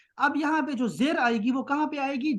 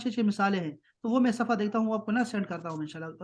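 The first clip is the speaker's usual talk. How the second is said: usual speaking voice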